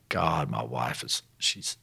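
low, slow, soft plea; halting, hushed imitation of praying; petitioning prayer. Husband praying about his wife, quiet petition. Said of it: "petitioning God".

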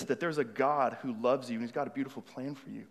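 ordinary talk, nothing marked

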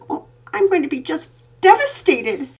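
put-on a little girl declaring heartbreak; devastated little girl. A narrator's reading. Daughter telling her father how devastated she'll be. Penelope voiced high.

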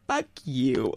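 high, laughing mock fury; mock jealous rant. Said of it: "I was like".

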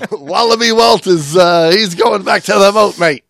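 high, loud hammed-up Aussie voice; mock Australian accent. Host answering the cohost, mock Australian accent. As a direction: answer like a cartoon Australian diver. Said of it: "continues Aussie bit".

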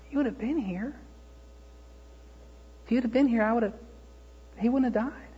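high, soft, halting lament; grieving reproach. Acted. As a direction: plead softly through hurt, with long pauses. Voicing grieving Martha's reproach to Jesus.

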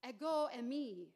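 loud, proclaiming call; Jesus calling over the waves; ringing out. Acted. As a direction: let it ring across the water. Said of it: "it rings out over the water".

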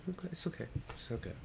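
calm him down quietly; soft reassurance. Retold or imitated. Passenger quietly accepts apology.

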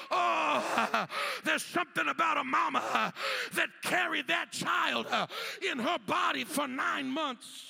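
loud, driving preacher's cadence; full-force preaching. Acted. Preacher rousing the mamas in the church, loud and fervent.

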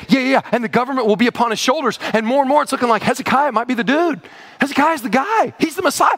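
high-pitched imitation of eager readers; mounting excitement. Readers thinking Hezekiah is the Messiah, giddy excitement. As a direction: get carried away with hope, pitch climbing high. Acting hopeful readers expecting the king.

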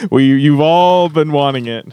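eighties TV voice. Acted. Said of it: "eighties T V voice".

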